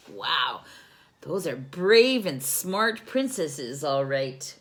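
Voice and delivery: ordinary delivery, nothing marked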